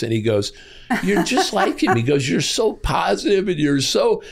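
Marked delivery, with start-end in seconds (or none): 1.0-2.0 excited friend. Retold or imitated. Friend comparing him to Robbins. 2.3-4.2 enthusiastic friend. Retold or imitated. Friend praising him, retold.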